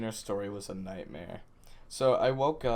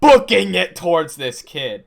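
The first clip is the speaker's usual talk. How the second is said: louder and higher, caught up in the story; rushing to tell the wild part; getting loud and excited; 7 semitones higher, 17 dB louder than usual. Retold or imitated. Telling friends the funny chase story, louder and higher.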